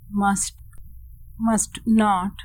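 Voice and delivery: ordinary delivery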